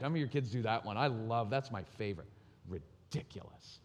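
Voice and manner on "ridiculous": slow, softer, whispered scorn; contemptuous. Retold or imitated. Kid scorning his parent, whispered contempt. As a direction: drip with contempt under your breath. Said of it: "There's so much contempt for the parent".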